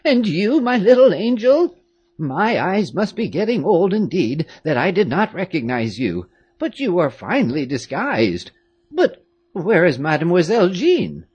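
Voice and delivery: put-on a doting old servant, amazed; old woman greeting the girls; old nurse. A narrator's reading. Old nurse Louise voiced.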